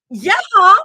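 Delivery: high, loud insistent yeah. Acted. Zoe insists back at Rumi in a silly squabble, high and loud. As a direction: throw it back like a sibling.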